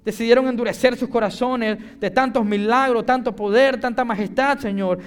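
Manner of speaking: high, loud, emphatic preacher's delivery; impassioned public prayer; fervent preaching prayer. Real, not acted. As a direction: press each phrase home with fervour.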